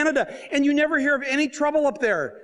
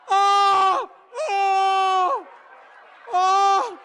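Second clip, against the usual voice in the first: high, loud moaning oh oh oh; anguished mock wail; 8 semitones higher, 3 dB louder, much slower than usual. Voter panicking at the ballot, loud anguished mock wail. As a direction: wail it like your heart sinks.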